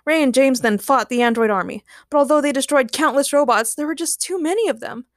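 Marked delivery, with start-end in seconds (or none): none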